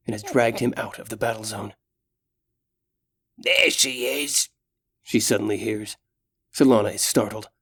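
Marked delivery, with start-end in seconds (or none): 3.4-4.5 slow orc voice. Acted. Orc spots her.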